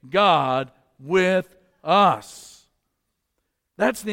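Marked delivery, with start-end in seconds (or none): 0.1-2.7 loud, drawn out word by word; leading a congregational response. Real, not acted. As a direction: say it big and very slow for all to join. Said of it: "say it with me".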